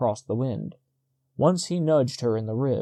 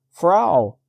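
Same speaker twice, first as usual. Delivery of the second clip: loud high call after her; shouting; 6 semitones higher, 7 dB louder than usual. A narrator's reading. Peduzzi calling to the departing wife, loud shout. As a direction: shout her back as she leaves. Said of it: "he shouted".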